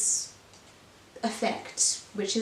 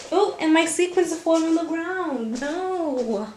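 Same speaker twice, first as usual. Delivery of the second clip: higher, louder surprised cry; oh no, sequins everywhere; a real little yelp of dismay; startled dismay; 6 semitones higher, 5 dB louder than usual. Real, not acted. Sequins falling off dress.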